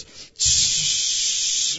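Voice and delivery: long, loud, sustained hiss; imitated freeze spray. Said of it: "And so he goes".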